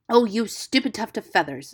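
loud, scolding outburst; angry. A narrator's reading. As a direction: scold an airheaded friend with some heat. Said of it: "said Rikki Tikki angrily".

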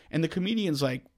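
ordinary talk, nothing marked

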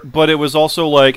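normal talking voice

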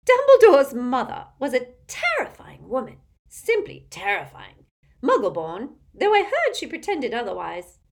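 loud, domineering Auntie Muriel character voice; imperious tipsy old aunt. A narrator's reading. Aunt greeting Harry at the table, imperious and loud. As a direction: project like a tactless old relative.

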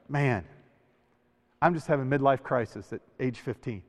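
low, soft grumble to oneself; mock weary moan. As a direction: sigh it out as if lying in bed.

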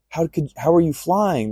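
retold high-pitched disbelief; freaking out. Worshippers to the flying visitor, mock freaked-out question. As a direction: imitate stunned onlookers, voice jumping up. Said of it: "They're all freaking out".

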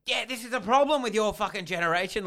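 high, loud mock tirade; imitated angry yelling. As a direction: rant like an irate driver scrambling for insults. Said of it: "he keeps yelling at me".

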